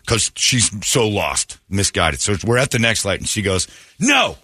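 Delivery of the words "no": high-pitched retold cursing; mock outburst. Wife cursing out her husband at the light, mock fury. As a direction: imitate an angry wife's high outburst.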